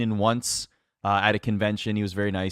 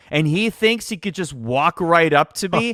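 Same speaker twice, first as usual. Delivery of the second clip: big, loud, higher indignant boss voice; mock outraged boss; 6 semitones higher, 4 dB louder, slower than usual. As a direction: ham up a scornful veteran announcer. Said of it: "turns to the writer and goes".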